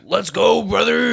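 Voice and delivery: high, shouted wrestler catchphrase; Hulk Hogan. Acted. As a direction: yell it like a pumped-up wrestler. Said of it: "I'm Hulk Hogan".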